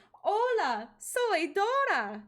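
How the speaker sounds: high, loud, very slow cartoon kid voice; sing-song it like a kids' show host; Dora impression. Showing off impressions to friend, high slow cartoon Dora. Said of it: "There it is".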